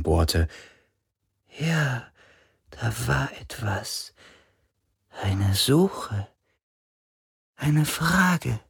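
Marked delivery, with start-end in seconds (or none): 1.6-8.6 faint high spirit voice; a ghost searching its memory. A narrator's reading.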